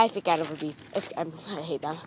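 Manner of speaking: ordinary talk, nothing marked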